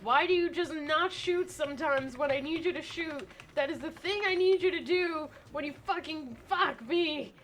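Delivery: heated complaint. Real, not acted. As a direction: argue with the game, pressing each word. Arguing with the game.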